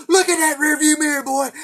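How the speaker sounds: put-on high, loud furious bark at a kid; screaming state trooper; angry trooper yell. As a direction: blow up at the boy like an outraged trooper. Trooper yelling after bribe.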